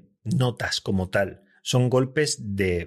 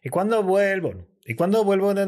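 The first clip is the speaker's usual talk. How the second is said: quick repeated line; 7 semitones higher than usual. Shown as a demonstration. Host showing how easy the line is, quick. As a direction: rattle off "¿Y cuándo vuelvo?" in one go. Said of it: "Todo seguido".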